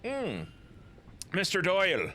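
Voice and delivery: normal talking voice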